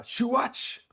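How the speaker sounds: high, loud burst; Ultraman battle cry. Shown as a demonstration. Actor performing for the team in booth, heroic cry. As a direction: fire it out as a booth take. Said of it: "you're gonna do a performance".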